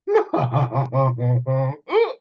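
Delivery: loud mocking laugh; crowd laughing. Crowd laughs at Bo's request, loud laughter. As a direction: laugh at the boy. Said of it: "But the crowd laughed".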